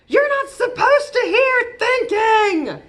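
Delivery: high, loud shouted protest; shouting. Acted. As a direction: yell it back at Mama. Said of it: "Sullivan shouts".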